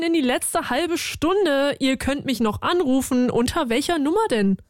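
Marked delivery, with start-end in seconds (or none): none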